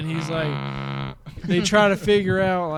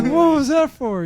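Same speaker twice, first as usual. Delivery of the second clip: mock shock; 9 semitones higher, 6 dB louder than usual. Quoting shocked reaction to thrown phone.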